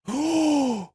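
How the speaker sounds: sharp high gasp of delight. Acted. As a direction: gasp like you just saw treasure. Delighted at invisible items returning.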